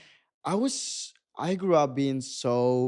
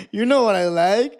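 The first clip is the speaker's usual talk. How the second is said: laughing, a little higher and louder; 8 semitones higher, 11 dB louder than usual. Retold or imitated. Telling mom about boys, laughing it off. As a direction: giggle through the words. Laughing through own retold reply.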